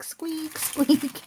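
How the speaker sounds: high squeaky imitation of a creaking chair; squeaky chair noise. Mocking her own squeaky chair with a high squeak. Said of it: "I can hear myself squeak".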